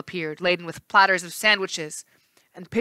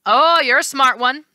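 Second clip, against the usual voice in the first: high, loud sneer; mock her with a sneer; the left face sneering at Annabeth; 7 semitones higher, 8 dB louder than usual. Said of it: "the left face sneered".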